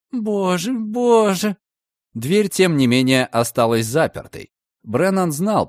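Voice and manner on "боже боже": high panicky wailing; frightened wail. A narrator's reading. Frightened woman behind the door, high panicked wail. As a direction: fret in rising panic.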